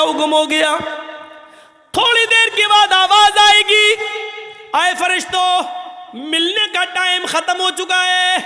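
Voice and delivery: fiery storytelling sermon to the congregation; preaching at full force. Acted. Preacher tells the Judgement story.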